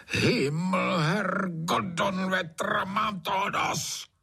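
high-pitched, drawn-out furious cursing; fed-up landowner's high roar; roaring outburst. A narrator's reading. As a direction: bellow each curse at a high pitch. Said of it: "zařval Trautenberg".

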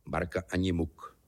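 ordinary delivery

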